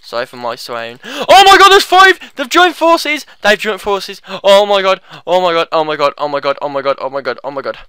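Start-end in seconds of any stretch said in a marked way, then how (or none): 1.0-7.8 panic winding down. Real, not acted. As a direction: start screaming, then fade into repeated muttering dread. Sees five enemies join forces.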